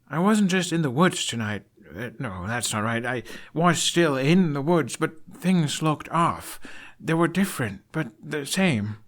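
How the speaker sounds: put-on a young man struggling to explain; searching Hiccup. Hiccup explaining strange woods.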